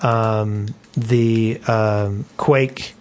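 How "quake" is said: ordinary talk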